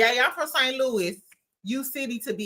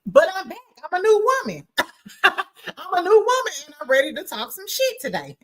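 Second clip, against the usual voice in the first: excited comeback; 8 semitones higher than usual. Real, not acted. Vlogger to viewers, proud she is back, excited. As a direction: declare it with a laugh in the voice. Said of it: "announcing comeback".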